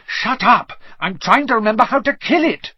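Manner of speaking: high, rushed retort; flustered snapping. Acted. Hermione silences Ron while trying to remember, flustered snapping. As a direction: snap back while racking your brains. Acted quarrel while plant strangles them.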